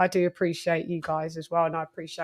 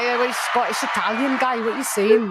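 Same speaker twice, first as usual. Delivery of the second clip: higher, lightly Scottish retort; a hint of an offended Scot; a touch of Scottish pushback; mock Scottish accent; 4 semitones higher than usual. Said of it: "Josh Taylor's like".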